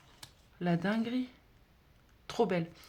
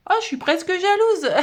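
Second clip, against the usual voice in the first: mock jealousy; 10 semitones higher, 9 dB louder than usual. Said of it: "Non, je plaisante".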